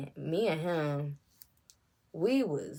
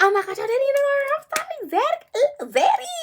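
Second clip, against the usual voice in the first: much higher, squealing and laughing; a giddy, embarrassed squeal at the dad; flustered squeal; 8 dB louder, faster than usual. Retold or imitated. Embarrassed to learn his dad is listening, giddy squeal.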